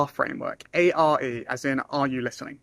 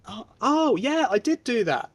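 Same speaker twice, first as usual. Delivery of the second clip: mock recognition; 8 semitones higher, slower than usual. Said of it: "And then they go".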